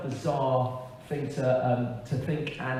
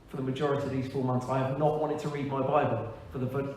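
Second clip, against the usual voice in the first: ordinary delivery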